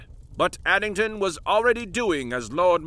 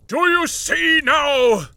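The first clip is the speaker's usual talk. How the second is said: slow, much higher and louder triumphant howl; 11 semitones higher, 7 dB louder, slower than usual. Acted. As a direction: howl it to the wild heavens, slowly. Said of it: "Marley howled".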